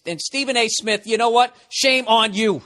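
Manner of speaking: ordinary talk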